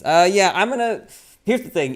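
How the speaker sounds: normal talking voice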